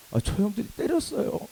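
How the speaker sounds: a hint of high-pitched complaint; lightly acted tattletale; high plea to a protector, mildly hammed-up; tattling kid. Beaten boy tattles to older friend.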